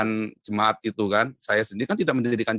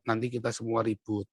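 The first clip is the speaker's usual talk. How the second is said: much softer, fatherly warning; imitated father's counsel; 2 semitones lower, 9 dB quieter than usual. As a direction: quietly caution as a father would. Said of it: "ayah saya selalu bilang". (menirukan peringatan ayah dengan suara pelan)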